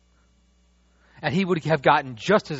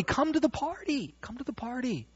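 calling invitation; 11 semitones higher, 4 dB quieter than usual. Retold or imitated. Father calling everybody in.

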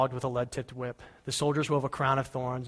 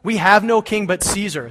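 loud and much higher; shouted back; 7 semitones higher, 10 dB louder than usual. Acted. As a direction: fling it back defiantly. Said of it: "shouted back".

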